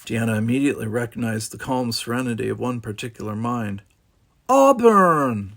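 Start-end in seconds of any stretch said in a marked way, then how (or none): 4.5-5.5 loud high call across the room. A narrator's reading. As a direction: call her name out, bright and loud. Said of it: "she called out".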